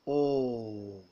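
"O" as a long, tense, rounded back aw vowel; model vowel sound. Shown as a demonstration. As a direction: hold "O" long with rounded lips, as in "fall". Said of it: "and rounded vowel".

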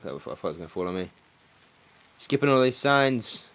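ordinary talk, nothing marked

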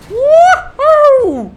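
high, loud laughing whoop; wild whoop. Real, not acted. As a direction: whoop with thrill. Wild escape. (heyecanla çığlık atan kahkaha)

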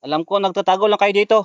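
loud call to hidden ones. Real, not acted. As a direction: shout into the dark at those hiding. Calling to hidden ghosts.

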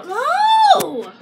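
high, loud "no"; cried-out protest. Real, not acted. Child interrupted mid-video, crying out in protest. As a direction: cry out to stop it.

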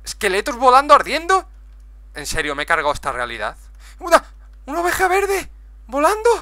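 high, loud astonished yells; shocked shouting. Real, not acted. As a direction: shout in wild disbelief. Sees flying burning skeletons.

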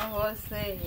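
usual speaking voice

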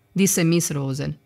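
usual speaking voice

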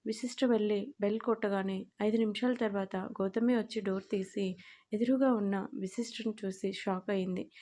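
plain reading voice

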